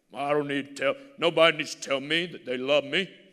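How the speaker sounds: loud, mock-tough bragging voice; preacher mocking a proud, hardened man, loud and boastful; prideful mockery. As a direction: ham up the proud man who needs no one. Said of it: "So if you're so prideful".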